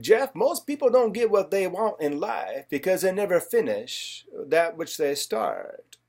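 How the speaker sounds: put-on Southern accent, dragging out the last words; Zig Ziglar. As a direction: imitate a Southern motivational speaker, slowing right down. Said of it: "in his southern voice".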